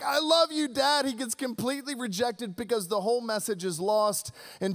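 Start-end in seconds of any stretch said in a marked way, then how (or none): 0.0-1.1 high, pleading elf voice; tender Buddy. Retold or imitated. Buddy the Elf declaring love to his dad, high. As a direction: reach up high like a lovestruck kid. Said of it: "he's like".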